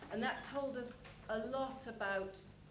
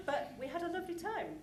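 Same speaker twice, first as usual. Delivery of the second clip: real laugh through the words, higher; chuckling fondly as she remembers; 8 semitones higher than usual. Real, not acted. Laughing about chaotic rehearsal.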